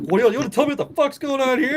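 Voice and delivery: high, fast, indignant demand; imitated yelling. Angry chief confronts the other guy at the door, mock yelling. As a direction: act out an irate boss demanding answers. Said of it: "I heard you yelling at the other guy".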